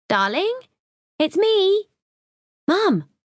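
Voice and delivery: a breezy mum calling from holiday; high cheerful mother on the phone; Mum's voice. Acted.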